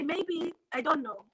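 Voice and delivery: put-on African accent. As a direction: lightly mimic an African accent. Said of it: "I put on my African accent".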